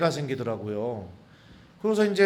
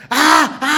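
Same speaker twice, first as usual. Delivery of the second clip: high loud groan of pain; hammed-up moan of a woman in labour; mock labour groan; 8 semitones higher, 11 dB louder, much slower than usual. Sister-in-law groaning while scolding the priest.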